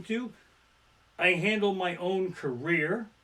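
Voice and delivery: usual speaking voice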